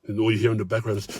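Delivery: ordinary delivery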